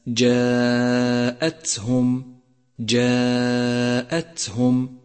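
جاءتهم with breath flowing on the ta, slow; whispered ta. Shown as a demonstration. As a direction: let air run through the ta of جاءتهم. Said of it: "لاحظ صفة الهمس". (همس التاء في جاءتهم)

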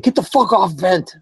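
high-pitched mock-furious outburst; angry mom impression. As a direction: imitate a mother raging into a headset. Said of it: "angry on Vent".

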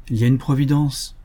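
ordinary delivery

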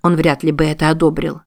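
usual speaking voice

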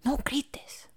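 whispered plea not to scream. Acted. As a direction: hush her before she screams. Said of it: "susurra Lupe".

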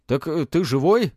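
loud, high astonished question; a spirit taken aback; surprised. A narrator's reading. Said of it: "удивлённо спросил один из духов".

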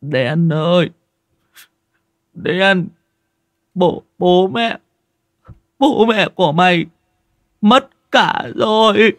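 high, slow broken-up sobbing words. Acted. Ty tearfully telling Den his parents died, a touch of sobbing. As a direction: choke out the bad news. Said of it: "mà nức nở".

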